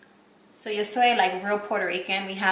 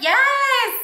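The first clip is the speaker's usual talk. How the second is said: much higher and louder, near a squeal; ecstatic yes; 6 dB louder than usual. Retold or imitated. Wife answers her husband, squealing with joy. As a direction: burst out a thrilled yes. Overjoyed after meeting idol.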